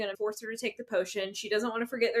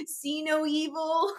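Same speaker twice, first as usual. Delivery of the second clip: slow, hushed see no evil; hiding under the blanket, mock-timid; small scared voice; 6 semitones higher, slower than usual. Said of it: "She's like".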